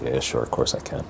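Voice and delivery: low, soft, breezy self-assurance; confident and cocky. Retold or imitated. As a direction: toss it off with quiet cockiness. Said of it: "feeling a little confident and cocky".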